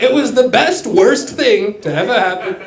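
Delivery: high bragging superlatives; Trump impression. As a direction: boast in superlatives like a salesman. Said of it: "That's not the worst Trump impression".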